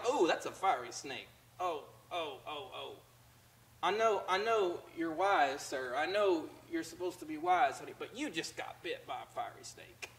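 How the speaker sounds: tut-tut softly at a bitten friend; mock sympathy. Mocking a wise churchgoer.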